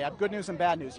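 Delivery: normal talking voice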